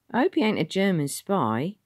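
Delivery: very high, loud Cockney warden; a know-it-all old London driver; Ern the ex-cabby. Acted. Ern answering the ticket man, high and loud. Ern's voice.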